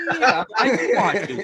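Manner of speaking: loud, high, laughing yes; over-eager suitor, hammed-up; mock eagerness. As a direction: act wildly keen, half cracking up. Acting eager reply, breaking into laughter.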